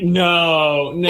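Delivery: hammed-up appalled refusal; mock horror. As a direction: wail it in playful dismay. Acting two characters' horror at pizza.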